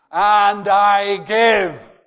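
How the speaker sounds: preacher crying out the promise; triumphant shout. Real, not acted. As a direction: ring out the climax. Climax after soft quote.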